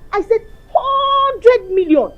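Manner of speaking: slow, loud, tearful wail on hundred million; crying wail. Acted. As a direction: drag out "hundred million" as a weeping cry. Insisting, crying out.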